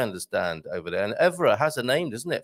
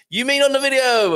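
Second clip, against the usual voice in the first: mock surprise; 12 semitones higher, 7 dB louder than usual. Imagined listener's question.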